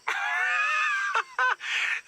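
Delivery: very high squealing laughter; high, drawn-out giddy cackle. Acted. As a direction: crack up after raving about the party. Giddy after the party.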